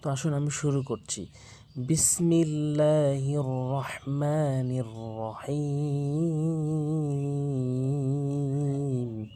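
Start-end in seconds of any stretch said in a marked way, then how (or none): 1.8-9.3 slow, drawn-out chanted bismillah; slow Arabic tajweed chant; Quranic recitation. Acted. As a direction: intone it slowly like tilawat.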